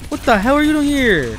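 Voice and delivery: high, loud disbelief; startled shout. Real, not acted. Player surprised by an enemy, startled high shout.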